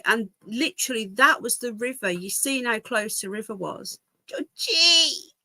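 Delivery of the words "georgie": high sing-song call; higher pet-name call; calling the dog. Real, not acted. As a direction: croon the dog's name.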